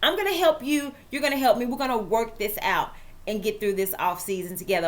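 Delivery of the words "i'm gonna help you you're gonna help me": normal talking voice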